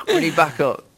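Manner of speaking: ordinary talk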